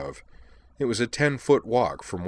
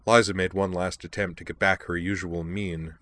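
usual speaking voice

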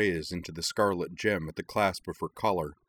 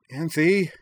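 much higher, loud alarmed cry; cried out; 11 semitones higher, 7 dB louder than usual. Acted. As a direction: call out in horror to stop her. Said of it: "Utena cried".

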